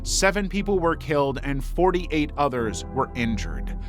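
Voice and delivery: normal talking voice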